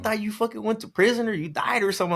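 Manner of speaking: high-pitched, incredulous retelling; retold disbelief. Old friend who thought he was gone, disbelieving. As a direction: imitate a friend's amazement, pitched well up.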